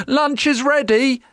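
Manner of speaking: high-pitched mum's call. A narrator's reading. Mum calls Jack in from play, calling out high. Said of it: "little Jack's mum cried".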